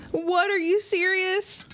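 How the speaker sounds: high slow mock disbelief; mock shock. Streamer plays shocked fish at the blacksmith's news. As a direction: stretch it out in fake shock.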